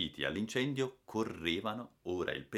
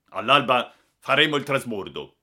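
firm announcement; 5 semitones higher, 11 dB louder than usual. A narrator's reading. Captain announces plan to crew.

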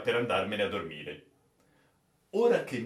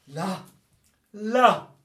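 high, choked exclamation; strangled cry, rising in pitch; 7 semitones higher, 6 dB louder than usual. Acted. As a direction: force it out through a tight throat. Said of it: "esclamò egli con voce strozzata".

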